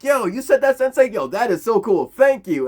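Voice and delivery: loud, high, gushing thanks; hammed-up overjoyed kid; mock excitement. Choji thanking his sensei for the deluxe meal, excited. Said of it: "Choji is excited".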